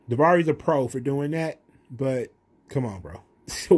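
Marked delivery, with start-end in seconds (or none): none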